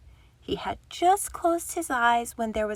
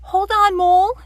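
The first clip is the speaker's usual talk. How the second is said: higher, much louder urgent call; calling up the tree to a friend in danger; cried out; 10 semitones higher, 10 dB louder than usual. Acted. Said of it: "he cried".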